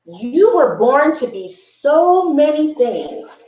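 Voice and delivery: ordinary delivery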